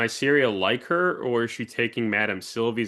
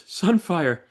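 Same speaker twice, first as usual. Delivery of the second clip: high, a bit louder, falling call of the name; calling the dragon; 8 semitones higher, 3 dB louder than usual. Retold or imitated. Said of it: "he goes".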